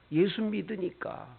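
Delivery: a hint of a tearful child, soft and slow; softly, as a young child confiding; child's voice. Lightly voiced child telling mother, soft and slow. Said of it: "우는 거예요".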